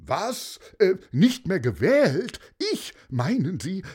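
mayor suddenly alarmed by the news, crying out; startled shout. Acted. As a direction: jolt awake in shock. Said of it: "schrie der Bürgermeister".

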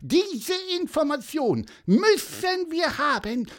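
high, squeaky official demand; piping little bird. A narrator's reading. Pieper demands information about Clara, high insistent chirp. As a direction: demand the facts in a tiny bird voice. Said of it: "piepste Pieper lauthals".

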